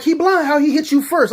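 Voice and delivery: high, loud disbelieving teacher; incredulous teacher. Retold or imitated. Dorm teacher doubting Nate, high loud disbelief. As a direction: scold the obvious lie. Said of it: "Missus Smith's like".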